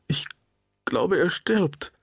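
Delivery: a frightened wife near tears over her dying husband; voice about to break. Acted. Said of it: "Ihre Stimme drohte zu kippen".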